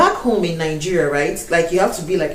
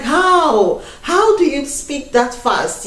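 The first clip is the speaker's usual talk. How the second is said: amazed question; 8 semitones higher, much slower than usual. Retold or imitated. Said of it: "I'm like".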